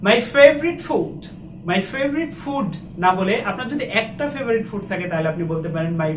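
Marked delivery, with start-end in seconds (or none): 0.1-2.7 higher, louder, slow "My favorite food"; emphatic model. Shown as a demonstration. Teacher loudly models the phrase for students. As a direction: announce it with energy. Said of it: "তাহলে আমরা কি বলবো?".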